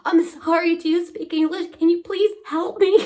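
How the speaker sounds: high, pleading mock distress; retold desperate plea. Fallen cyclist asks passing girl for help, pleading. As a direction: beg a stranger for help, high-pitched.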